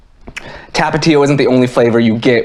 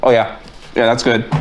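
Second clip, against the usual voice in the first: talking while chewing. Real, not acted. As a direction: mouth full of food. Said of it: "I'm sorry for the chewing".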